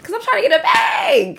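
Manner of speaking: shrieking it through laughter; high laughing yell; laughing shout. Real, not acted. Shouting punchline together laughing.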